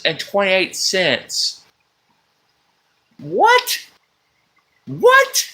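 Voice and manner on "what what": very high squeaky disbelief; hammed-up disbelief at the price; mock shock.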